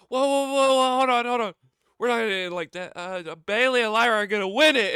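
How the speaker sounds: high, loud hammed-up whoa hold on; playful loud Bayley impression; mock Bayley. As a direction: wave down a booing crowd. Imitating Bayley calming crowd.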